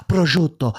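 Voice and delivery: Prosciutto with every sound rolled and stretched; over-enunciate Prosciutto like a TV chef; overdone Italian. Shown as a demonstration. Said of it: "I try not to go like".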